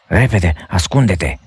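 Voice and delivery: ordinary delivery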